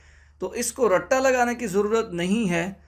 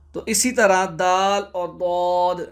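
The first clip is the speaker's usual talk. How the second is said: normal talking voice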